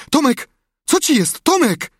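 high, loud cries of alarm; frightened calling. A narrator's reading. Sid shaking his silent brother, crying out alarmed. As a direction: shout in rising worry for someone unresponsive. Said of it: "z przerażeniem patrzył mu w twarz".

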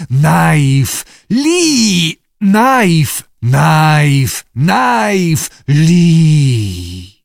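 loud barking newt calls; barking creature. A narrator's reading. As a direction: bark the word like an animal. Newts bark at the humans.

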